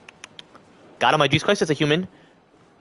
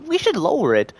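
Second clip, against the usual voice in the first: mock high voice; 8 semitones higher than usual. Mock leaders deciding.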